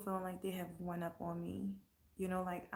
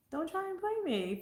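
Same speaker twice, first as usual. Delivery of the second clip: Don't try and play me, pitched much higher, sassy; high mock warning; 11 semitones higher than usual. Real, not acted. Warning people not to play her, sassy and high. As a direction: repeat it up high with attitude.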